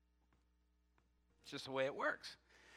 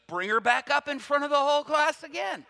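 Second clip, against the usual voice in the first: high, raised-voice order; teacher's loud command; 8 semitones higher, 7 dB louder than usual. Retold or imitated. Teacher calling student back up front, loud command. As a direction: call it out insistently, high and loud.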